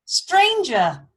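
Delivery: loud, high commanding call, falling away. A narrator's reading. King calls to a stranger in his hall, loud call. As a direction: call out across a great hall. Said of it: "called Higelac".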